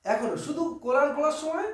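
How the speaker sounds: usual speaking voice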